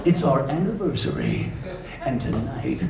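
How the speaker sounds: slow, low, soft announcer seduction; movie trailer narrator wooing his wife; romantic trailer voice. Acted. Trailer guy getting romantic with his wife, slow and deep. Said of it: "trying to get romantic with his wife".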